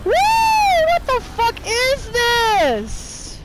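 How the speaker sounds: very high, loud, drawn-out yelling; outraged scream. Real, not acted. Player raging at an enemy still alive, outraged scream. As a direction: scream every word slowly in outrage.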